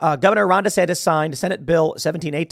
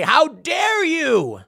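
How dare you drawn out slowly, much higher; mocking outraged protesters; 11 semitones higher, much slower than usual. Host mocking Florida protesters' outrage, slow and high. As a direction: ham up shrill protester indignation, very slow. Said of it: "they say".

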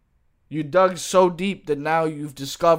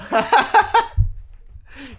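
high laugh; 12 semitones higher, 6 dB louder than usual. Real, not acted. Laughs at own singing.

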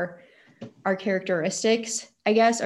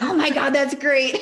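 laughing delight; 7 semitones higher, 6 dB louder than usual. Retold or imitated. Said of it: "And he's like".

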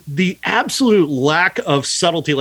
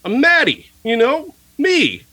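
higher, overacted father voice; mock movie father, pitched up and hammy; mock dad; 6 semitones higher, slower than usual. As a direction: ham up the clumsy dad reassuring his son. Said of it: "who the fuck talks like this?".

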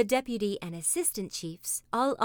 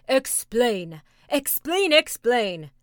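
crowd crying out; 3 semitones higher, 9 dB louder, slower than usual. A narrator's reading. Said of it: "cried all the tribe".

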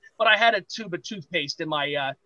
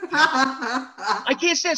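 mortified groan; 7 semitones higher, much slower than usual. Retold or imitated. Mortified, crowd laughing at him.

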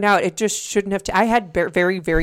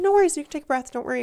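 imitated reassurance; 9 semitones higher, much faster than usual. Lady calming the bride before the ceremony, reassuring. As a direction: mimic a high-pitched calming helper.

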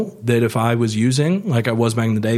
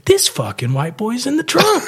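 surprised thug; 12 semitones higher than usual. Retold or imitated. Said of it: "next thing I hear is".

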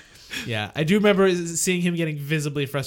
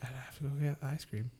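sleepy mumble; 13 dB quieter, faster than usual. Retold or imitated. Sleepwalking kid insists.

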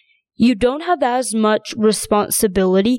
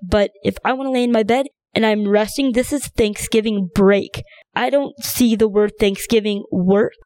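ordinary talk, nothing marked

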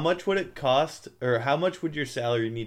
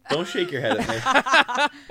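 laughing mock scolding; 12 semitones higher, 5 dB louder than usual. Teasing someone in audience.

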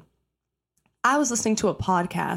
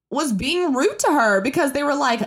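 ordinary delivery

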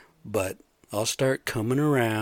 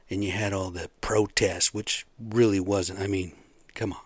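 ordinary delivery

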